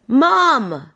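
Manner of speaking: put-on high call of a kid for mum; mock child calling out; imitated kid's shout. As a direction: call for Mum like a lost kid, lightly done. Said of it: "a little kid shouting for their mum".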